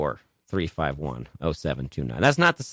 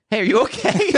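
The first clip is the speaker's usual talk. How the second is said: very high, tentative mock check-in; timid concern; 5 dB louder than usual. [name] leans over to the cursing man, high mock-timid concern. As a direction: imitate a neighbour nervously checking on someone.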